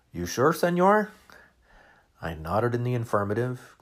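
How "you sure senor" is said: put-on waiter's Spanish accent. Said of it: "and said".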